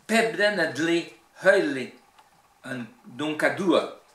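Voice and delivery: Breton verse with strong Cornouaille stresses; emphatic Cornouaille Breton recitation; Cornouaille Breton accent. Shown as a demonstration. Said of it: "l'accent cornouaillais".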